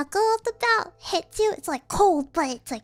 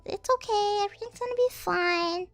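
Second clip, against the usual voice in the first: soothing parent; 5 semitones higher than usual. Retold or imitated. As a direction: comfort a fallen kid, lightly mocked.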